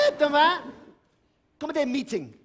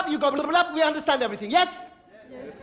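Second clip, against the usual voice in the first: ordinary talk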